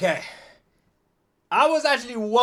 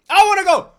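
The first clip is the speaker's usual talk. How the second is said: high, loud excited plea; eager; 10 semitones higher, 8 dB louder than usual. Real, not acted. Listener desperate to go to India, excited. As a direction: burst out with longing. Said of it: "This sounds amazing".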